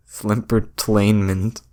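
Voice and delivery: "slempertainment" said slowly, syllable by syllable; drawn-out made-up word. Shown as a demonstration. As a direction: spell out "slempertainment" slowly with your voice. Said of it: "sounding out made-up word".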